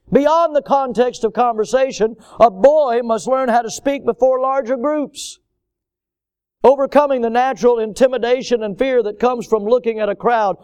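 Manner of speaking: full-force preaching. Acted. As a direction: drive the point home to the congregation.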